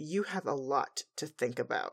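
a touch of a stern mentor; disappointed Celestia. Retold or imitated. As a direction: let her down gently, as a mentor. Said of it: "she just looks at her".

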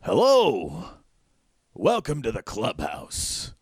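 wide-eyed greeting like Doc Brown; crazy doorman. Acted. Huge robed man greets the party at the door, wild-eyed. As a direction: manic host welcoming guests in. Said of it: "and he goes".

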